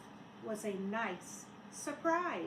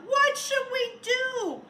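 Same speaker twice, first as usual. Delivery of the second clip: high, loud, slow worried cry; high, loud worried children; 11 semitones higher, 9 dB louder, slower than usual. Acted. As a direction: call out like scared kids. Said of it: "The children cried out".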